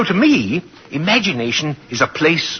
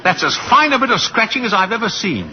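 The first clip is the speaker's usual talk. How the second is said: delighted praise; 7 semitones higher than usual. Acted.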